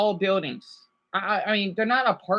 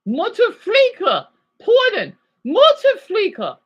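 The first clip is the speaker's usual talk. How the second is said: slow, high, loud mock German cursing; 11 semitones higher, 6 dB louder, slower than usual. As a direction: bark it in a hammed-up German accent. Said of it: "I had to speak German".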